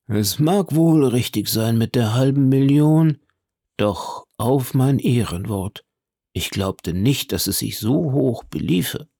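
lightly voiced major, taken aback by a fortune; a hint of an old soldier's wondering voice; astonished old major. Narrator voices the astonished Luccheser.

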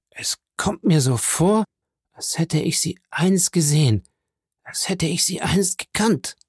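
faint breathless whisper; dying man whispering recognition. A narrator's reading. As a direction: barely breathe the memory.

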